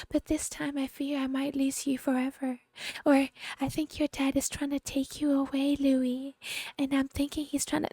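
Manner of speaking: high, soft, sugary mock mother; soft-spoken imitation. Mocking the mother cooing to her son, high and soft. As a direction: coo it like a fake-sweet mother. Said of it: "she's so soft-spoken".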